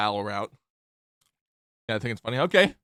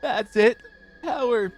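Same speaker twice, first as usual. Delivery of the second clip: high, slow, desperate game character; straining character voice; 14 semitones higher, 4 dB louder, much slower than usual. Acted. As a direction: strain for the lost memories.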